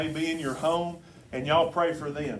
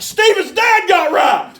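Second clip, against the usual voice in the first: high, slow, loud shout of joy; joyful shout; 16 dB louder, slower than usual. Retold or imitated. Pastor realizing the news in church, shouting with joy. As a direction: burst out with the news so the lobby hears. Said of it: "she's like, "Shh".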